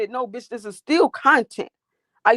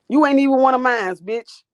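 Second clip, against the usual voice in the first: angry telling off; 5 semitones higher, 6 dB louder than usual. Real, not acted. Telling off a troll.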